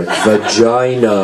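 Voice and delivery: slow, stretched-out announcement; very slow, savored delivery; deliberately drawn-out word. Shown as a demonstration. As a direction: draw out every syllable for comic effect. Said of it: "Say it when you're at the supermarket".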